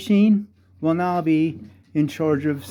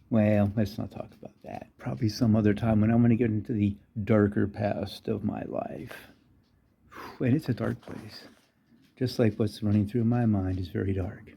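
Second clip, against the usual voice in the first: low, subdued aside; darkly confiding, trailing away; mock brooding; 5 semitones lower, 6 dB quieter than usual. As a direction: drop soft and low, as if haunted. Mock dark confession about his past.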